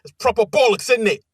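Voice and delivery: tossed-off Cockney impression of the intern; Cockney accent. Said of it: "English Cockney accent".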